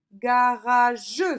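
slow "Garageux" in three beats, ending in a full "jeu"; final syllable sounded. Shown as a demonstration. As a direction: sound out "Garageux" with an added last vowel. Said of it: "sur la troisième syllabe". (« Garageux » en trois syllabes, e final prononcé)

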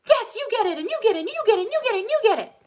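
high, loud giveaway host shout; Oprah impression. Teacher praising the whole class like Oprah, loud and high. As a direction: hand out answers like Oprah hands out cars. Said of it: "I'll be like, Oprah".

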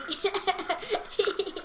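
giggling from tickles; being tickled. Real, not acted. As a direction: laugh through the tickling. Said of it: "tickling her".

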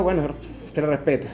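usual speaking voice

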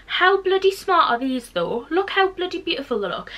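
high thrilled gushing. Real, not acted. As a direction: rave about them, delighted. Gushing over shoes.

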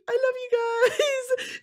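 high, slow, breathy-cry gush of love to the crowd; mock teary stage gush; gushing band member. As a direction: swoon it out like a singer on stage. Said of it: "They're like".

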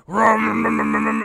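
loud imitated rumbling bass dialogue; muffled bass rumble. Host imitating muddy bass-heavy movie sound, loud rumble. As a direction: mimic speech buried in bass. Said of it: "I'd hear".

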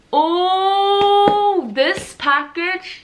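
high, drawn-out oh of surprise; real surprise, slow and breathless; surprised gasp. Real, not acted. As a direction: caught off guard by the box. Opening surprise PR package.

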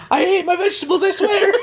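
high panicked pleading; panicked squeal. Acted. Panicked denial after "Mother.

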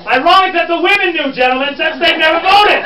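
loud, high theatrical indignation; mock exasperation. Guide chiding gentlemen as the women answer, loud mock outrage. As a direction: call the men out at full volume.

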